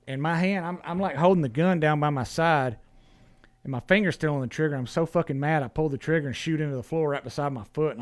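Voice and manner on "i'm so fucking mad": ordinary delivery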